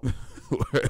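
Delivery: ordinary delivery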